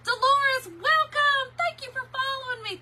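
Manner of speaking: high, loud welcome call; excited welcome. Real, not acted. Host welcomes a new follower, high joyful call.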